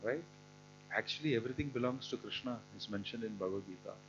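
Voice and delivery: usual speaking voice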